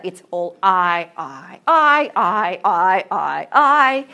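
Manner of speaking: loud, insistent repetition of "I"; repeated I stressed. Shown as a demonstration. Teacher showing how the first stanzas are all about himself, loud insistent "I". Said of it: "In the first and second stanza".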